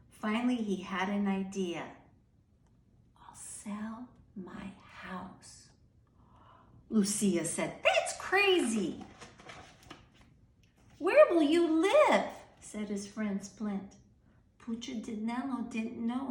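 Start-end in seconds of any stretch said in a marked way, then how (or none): 3.2-5.7 very slow unvoiced whisper; secret whisper. Punchinello whispering his plan to sell his house. 7.8-9.0 higher, louder, slow disbelief; shocked friend. Acted. Lucia telling Punchinello his plan is shocking. 11.0-12.3 much higher and louder worried cry; alarmed question. Acted. Splint asking Punchinello in alarm where he'll live.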